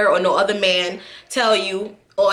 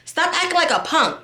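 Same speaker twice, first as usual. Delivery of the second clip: imitating scolding lady; 7 semitones higher than usual. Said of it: "and she said".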